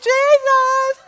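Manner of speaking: high, loud panicked "Jesus"; panicked cry. Retold or imitated. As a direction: yelp it in the dentist chair. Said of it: "I went, yes, I went".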